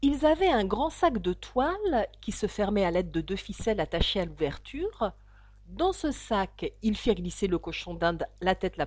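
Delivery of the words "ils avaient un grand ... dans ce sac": ordinary delivery, nothing marked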